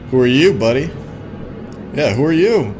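ordinary delivery

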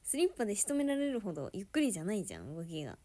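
ordinary talk, nothing marked

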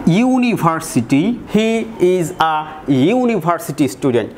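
ইউনিভার্সিটি said slowly, starting with a yu glide; slow teacher demo of the yu onset; yu sound demo. Shown as a demonstration. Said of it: "উচ্চারণটা কিন্তু ইউ এর মতো হচ্ছে".

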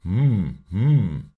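loud drawn-out hmm hmm; wondering hum. Acted. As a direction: hum aloud, puzzled. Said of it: "machte er".

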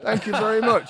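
laughing through a sarcastic reply to the surgeon; laughing sarcasm. Retold or imitated. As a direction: laugh it out as a sarcastic thank-you. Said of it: "I thought".

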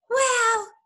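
high, loud burst; gasped exclamation. A narrator's reading. Smallest fox reeling from strong cider, gasping. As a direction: let it burst out after a strong gulp. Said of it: "he gasped".

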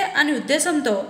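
plain reading voice

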